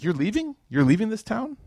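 high, incredulous double-take; surprised. Retold or imitated. Umar surprised at Umm Abdullah leaving town. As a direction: ask it like you can't believe she's going. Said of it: "Somebody that they didn't expect".

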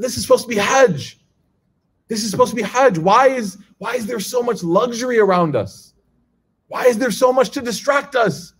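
high, whiny protest, lightly acted; mock upset complaint. Young pilgrims complain to the speaker, mock upset whine. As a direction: imitate upset young men complaining about luxury. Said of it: "They looked really upset".

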